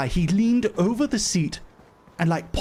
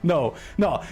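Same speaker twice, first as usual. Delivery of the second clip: normal talking voice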